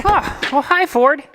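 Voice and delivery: normal talking voice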